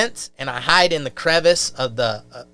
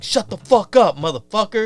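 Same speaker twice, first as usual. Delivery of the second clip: higher, louder retold yell; angry inmates; 6 semitones higher, 4 dB louder than usual. Huge inmates to crying kid, angry mock yell. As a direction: act out inmates silencing someone.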